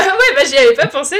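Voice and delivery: laughing through the words; real laugh, loud and high. Real, not acted. Laughing at partner's joke.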